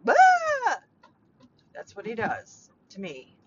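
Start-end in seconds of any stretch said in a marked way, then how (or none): 0.1-0.8 loud, very high "Ah!"; worked-up mock scream. Showing how stepdad sets her off, mock scream. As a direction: let out a sudden high yell of exasperation. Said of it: "see, I'm already, like, just".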